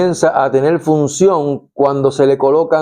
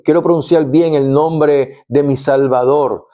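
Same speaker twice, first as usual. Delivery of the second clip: ordinary talk, nothing marked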